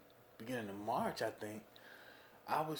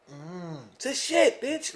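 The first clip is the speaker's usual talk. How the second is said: mock disbelief; 2 semitones higher, 7 dB louder, much slower than usual. Mocking sniffing approval.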